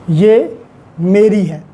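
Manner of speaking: usual speaking voice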